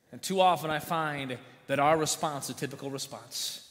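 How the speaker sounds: ordinary talk, nothing marked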